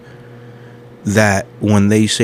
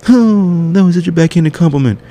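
mock-offended woman, much higher and louder; offended retort; 10 semitones higher, 4 dB louder than usual. Imagined woman snapping back at the speaker, hammed-up offense. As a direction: act the insulted girl, pitch it way up.

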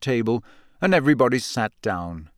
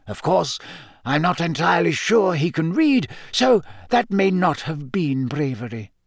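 Dumbledore voice; 7 semitones higher than usual. A narrator's reading. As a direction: tell it with dry old humour.

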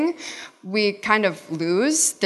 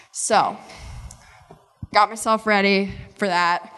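normal talking voice

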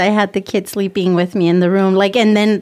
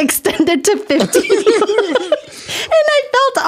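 laughing through the words, pitched up; real laughter at the awkward memory; 11 semitones higher than usual. Real, not acted. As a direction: giggle as you say it. Retelling awkward moment, laughing.

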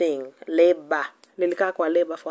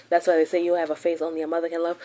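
usual speaking voice

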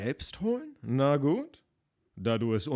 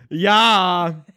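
loud, annoyed, exasperated yes; 4 semitones higher, 12 dB louder than usual. Acted. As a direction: bark it like you're fed up. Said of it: "Ich liebe dein genervtes Ja".

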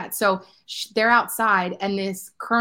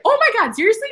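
shocked; 13 semitones higher, 7 dB louder than usual. Retold or imitated. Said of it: "And Marquesa's like".

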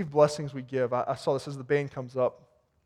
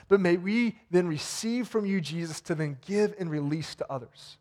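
closing prayer; 3 semitones higher than usual. Acted. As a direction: pray over the church with rising fervor. Pastor closes the sermon in prayer.

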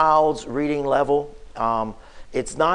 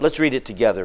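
usual speaking voice

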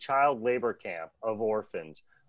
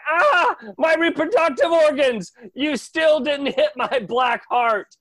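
pained villain shout; 13 semitones higher, 7 dB louder than usual. Retold or imitated. Insect King loses a body part and shouts in high, loud pain. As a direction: scream it out, then jeer. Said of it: "He's like".

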